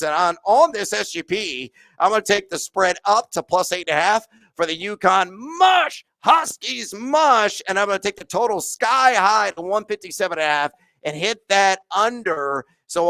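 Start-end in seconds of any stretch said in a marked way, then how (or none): 5.6-7.6 rallying shout. Real, not acted. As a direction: drive the sled dogs on. Cheering on the Huskies.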